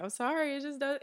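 usual speaking voice

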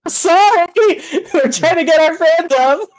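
high-pitched mock apology to the neighbourhood; shouted-out apology. Voicing characters calling out an apology.

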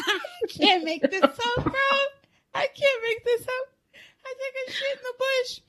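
high, helpless laughing through the words; cracking up. Real, not acted. Confessing a childhood story to co-host, cracking up. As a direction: barely get it out through high-pitched laughter.

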